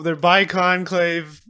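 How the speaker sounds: ordinary delivery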